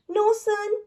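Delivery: high, loud astonished cry; exclaimed. A narrator's reading. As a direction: gasp it out in disbelief. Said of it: "exclaimed the wizard".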